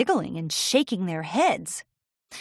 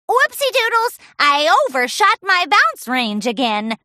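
high, loud bubbly pony voice; giggly Pinkie; 8 semitones higher, 6 dB louder than usual. Acted. Pinkie landing off course, high giggly exclamation. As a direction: overshoot and giggle it off. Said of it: "she giggled".

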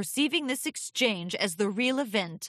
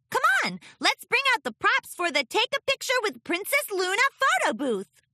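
high, loud excited filly; rally your friends, squeaking; squeaky filly; 13 semitones higher, 5 dB louder than usual. Acted. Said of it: "Sweetie Belle squeaked".

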